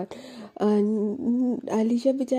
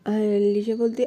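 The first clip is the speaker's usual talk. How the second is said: usual speaking voice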